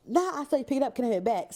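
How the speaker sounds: hammed-up loud demand for the card back; loud mock demand. Shown as a demonstration. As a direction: replay the line loud and pushy.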